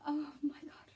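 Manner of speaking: breathless hushed dismay; stricken whisper. Real, not acted. Fan reliving Dewey seeing Gail call, stricken whisper. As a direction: whisper it, too sad to speak.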